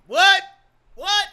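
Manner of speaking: high, loud mock shock; mock shocked what. Imitating people stunned by his gray eyes, loud.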